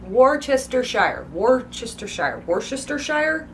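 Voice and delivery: slow Worcestershire as wor-chester-shire; drawn-out Worcestershire ending in a full shire; as written. Shown as a demonstration. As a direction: sound out every syllable of Worcestershire. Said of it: "strictly how it's written".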